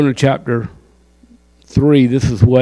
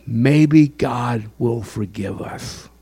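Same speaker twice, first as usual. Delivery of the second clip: usual speaking voice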